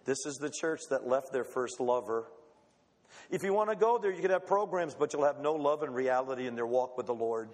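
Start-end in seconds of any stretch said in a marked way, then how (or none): 0.0-2.3 low, quiet, solemn voice of God; God's voice. Acted. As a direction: hand down the verdict gravely. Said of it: "God says".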